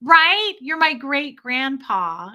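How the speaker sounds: loud high right, then slow teasing; cheeky agreement. Retold or imitated. Wife teasing her old husband in public, playful. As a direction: play along with a grin. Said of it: "I'd go".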